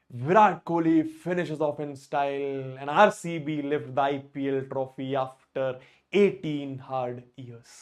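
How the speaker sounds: very slow, measured commentary line; commentator call. Retold or imitated. Teacher imitating Ravi Shastri's commentary to students. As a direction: savour each word like a broadcaster. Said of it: "Ravi Shastri जी की line की".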